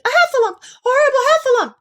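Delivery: very high, loud, rapid squeal; panicked cry. A narrator's reading. Piglet fleeing a Heffalump, panicked squealing cry. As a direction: shriek the alarm as a terrified little creature. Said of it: "cried Piglet".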